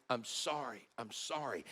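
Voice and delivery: hushed, broken repeated apology; the son at his dead father's bed, softly mimicking sobbed sorrys; retold crying apology. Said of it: "he's crying".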